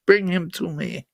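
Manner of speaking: soft, low attempt at a Russian accent as Putin; a failed Russian accent, hushed and menacing; mock Russian accent. Host mimicking Putin's demand in a bad Russian accent. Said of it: "That's not a Russian accent".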